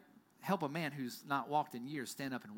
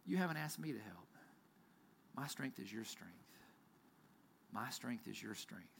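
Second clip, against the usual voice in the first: tender father; 7 semitones lower, 12 dB quieter than usual. Retold or imitated. Father gently reassuring son about strength, soft tender voice. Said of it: "And the father said".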